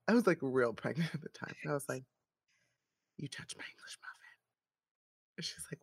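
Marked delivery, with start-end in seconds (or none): 3.2-4.4 hushed, much softer accusing whisper; menacing whisper. Retold or imitated. Pregnant woman confronting muffin-pusher, menacing whisper. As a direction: whisper it like a threat.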